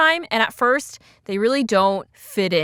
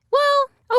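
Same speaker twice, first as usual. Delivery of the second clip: high, drawn-out "well"; Jerry; 4 dB louder than usual. Retold or imitated. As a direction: stall on a high-pitched, reluctant opener. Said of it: "Jerry says".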